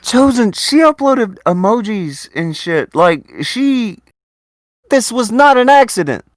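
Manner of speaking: high, loud, fed-up outburst; nearly shouting in disbelief; exasperated rant. Real, not acted. Ranting about paywalled clips.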